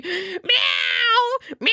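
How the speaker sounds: high, loud shouted meow; mock meowing. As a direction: yowl like a cat. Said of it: "The guy just starts going".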